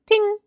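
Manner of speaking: very high pinged ding; high-pitched ding noise; ding sound. Retold or imitated. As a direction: imitate the bug landing. Imitating bug hitting something.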